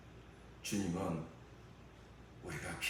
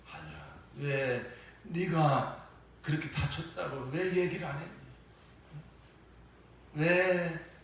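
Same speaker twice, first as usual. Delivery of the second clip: mother wailing over son; 7 semitones higher, 4 dB louder than usual. Retold or imitated. Grieving mother crying out to her wounded son, high and loud. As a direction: pour out a mother's grief, voice lifted and straining. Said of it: "막 통곡하고 울었다는".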